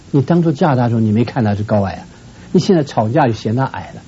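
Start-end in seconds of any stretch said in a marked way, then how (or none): none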